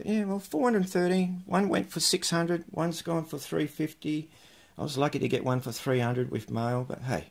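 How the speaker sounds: ordinary talk, nothing marked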